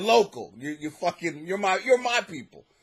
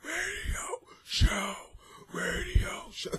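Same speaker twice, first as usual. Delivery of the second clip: slow, softer unvoiced whisper, said twice; 11 semitones higher, 6 dB quieter, slower than usual. Real, not acted. Comic hushes the name of his radio show to listeners. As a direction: breathe it like a guilty secret.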